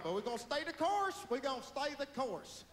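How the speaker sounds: high, loud rallying declaration; mock politician's insistence. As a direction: insist like a stubborn politician at a podium. Mocks Bush's stubborn insistence.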